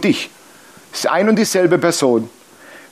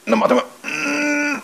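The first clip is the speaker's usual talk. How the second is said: mock straining; 11 semitones higher, 4 dB quieter than usual. Baby straining in the diaper.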